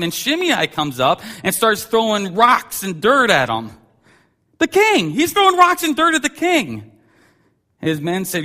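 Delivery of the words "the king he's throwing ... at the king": high, loud outrage at the insult; indignant exclamation. Real, not acted. Preacher outraged that a man stones the king. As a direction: cry out in disbelief at the insult.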